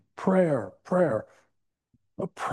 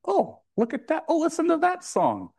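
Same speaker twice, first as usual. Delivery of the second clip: high-pitched mock discovery; acted pleasant surprise; 12 semitones higher than usual. Preacher acts out spotting coincidences for the congregation, delighted surprise. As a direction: act out suddenly noticing a sign. Acting out noticing a sudden coincidence.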